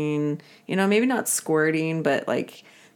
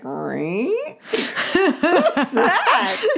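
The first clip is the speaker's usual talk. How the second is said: laughing squeal; 12 semitones higher, much slower than usual. Retold or imitated. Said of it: "And I'm like".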